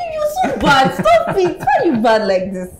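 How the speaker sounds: high, laughing mock scold; flirty scolding. Wife playfully scolding her husband, high laughing mock reproach. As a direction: squeal it as a playful tease.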